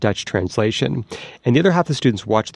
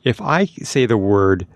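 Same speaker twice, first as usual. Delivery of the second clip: ordinary delivery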